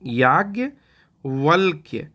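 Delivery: याज्ञवल्क्य drawn out slowly while writing it; slow dictation. Shown as a demonstration. Teacher writing याज्ञवल्क्य for students, slow dictation. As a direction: spell याज्ञवल्क्य out slowly as you write. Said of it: "इसको लिख के दिखाता हूँ".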